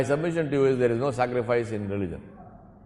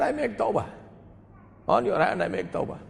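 high-pitched acted tearful vow; imitated weeping; 9 semitones higher than usual. Thief repenting before Hasan al-Basri, imitated tearfulness. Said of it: "the man is weeping and crying".

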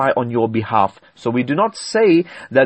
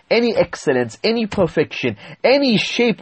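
ordinary delivery

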